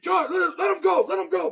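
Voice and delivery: urgent shouted command; frantic shout. Retold or imitated. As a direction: yell at him to let go. Brother yelling during fight.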